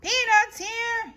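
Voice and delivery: high loud vendor call; vendor's yell. Acted. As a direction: hawk peanuts in the stands. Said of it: "yells a boy".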